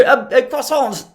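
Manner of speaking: high, slow put-on French; groping for French words, hammed-up accent; mock French accent. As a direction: draw it out in a playful French accent. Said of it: "the French accent is cute".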